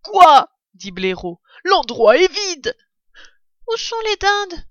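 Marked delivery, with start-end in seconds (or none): 0.0-0.5 loud, high "what?"; startled badger. A narrator's reading. Badger's startled reaction in story. 1.6-2.8 loud, high, drawn-out dismay; astonished badger. A narrator's reading. Badger finds the cellar empty. 3.7-4.7 put-on very high child-like voice; little fox cub. A narrator's reading. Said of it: "demanda le plus petit Renardeau".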